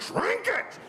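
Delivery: high, strained mock yell; desperate command. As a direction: bark it high at a thrashing dog. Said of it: "I'm like".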